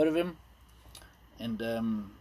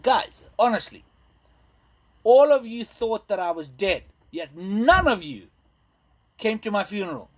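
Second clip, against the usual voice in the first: mock indignation; 5 semitones higher, 6 dB louder than usual. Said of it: "And I'm like".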